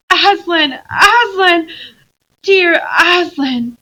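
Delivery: high, loud tearful cry of joy; sobbing with relief at finding him. Acted. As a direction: cry his name through happy sobs. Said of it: "sobbed Lucy".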